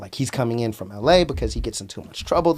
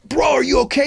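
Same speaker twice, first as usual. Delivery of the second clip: alarmed concern; 12 semitones higher, 3 dB louder than usual. Retold or imitated. Seeing bloodied friend.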